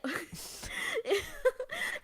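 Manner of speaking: squealing giggle; embarrassed high laugh. Real, not acted.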